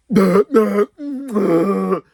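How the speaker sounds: a loud, high, real laugh that bursts out; loud burst of laughter. Real, not acted. Laughing at security who had no answer, loud and real. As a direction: laugh out loud, amused and triumphant.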